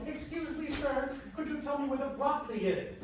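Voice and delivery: a polite, high-voiced shopper; little lady. Little lady asks stock boy for broccoli, high and polite. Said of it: "this little lady comes up behind him".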